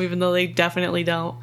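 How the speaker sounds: ordinary delivery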